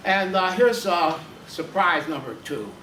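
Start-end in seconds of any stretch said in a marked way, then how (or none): none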